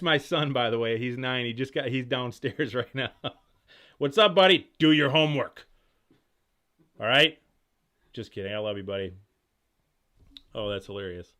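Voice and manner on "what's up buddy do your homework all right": calling out. Real, not acted. Calling down to son downstairs.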